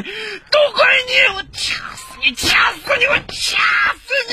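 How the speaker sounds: high, strained screams of rage; drawn-out, hysterical fury; murderous frenzy. Acted. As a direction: rage through the effort of strangling. Man strangles her in a frenzy.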